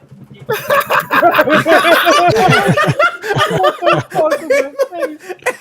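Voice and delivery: high, loud cackling laughter; loud laughing fit. Real, not acted. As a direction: burst out laughing. Cracking up at own story.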